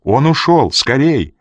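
high, urgent shout of news; calling out. A narrator's reading. Жанна reports the escape from upstairs, calling. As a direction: call down to hurry them. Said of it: "позвала Жанна с верхней площадки".

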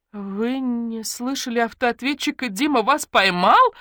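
high, slow, swelling horrified question; aghast; gasping in alarm. A narrator's reading. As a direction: stretch it out, rising to a loud cry. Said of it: "ахнула Наташка".

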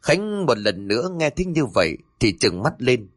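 ordinary delivery, nothing marked

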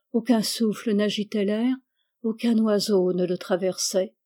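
ordinary delivery

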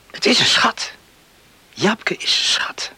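whispered, tender admission; hushed confession. Acted. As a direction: whisper about the girl he loves. Confiding in bed at night.

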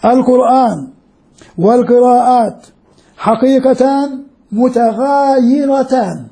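teacher quoting an authority, deliberate pauses; measured quote reading. Shown as a demonstration. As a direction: read the quote out slowly and weightily, word by word. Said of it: "اسمعوا".

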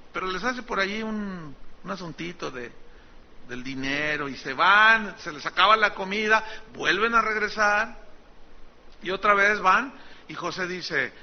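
normal talking voice

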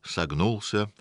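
usual speaking voice

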